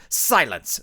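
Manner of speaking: ordinary delivery, nothing marked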